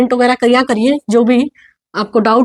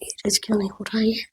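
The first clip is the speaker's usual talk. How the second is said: whispered grumble to self; muttered aside; 11 dB quieter than usual. Real, not acted. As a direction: say it under your breath, very softly. Muttering to self while erasing.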